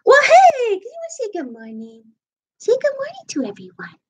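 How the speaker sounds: very high, sing-song cooing to a pet; pet-talk voice. Real, not acted. Owner asks pet to say good morning, high pet-talk voice. Coaxing her pet to greet viewers.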